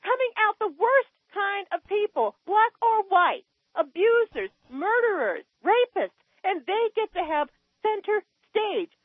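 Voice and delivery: worked-up rant with the voice climbing very high; high, indignant tirade; upset, high-pitched. Real, not acted. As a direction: let real outrage push your pitch way up. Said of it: "never heard your voice go up that high".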